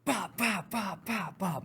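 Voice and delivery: punchy bop sounds, high; high clipped bop bop bop; imitated punches.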